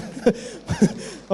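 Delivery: airless, whispery laugh; a soft wheezy chuckle; breathy laugh. Real, not acted. Laughs at own joke.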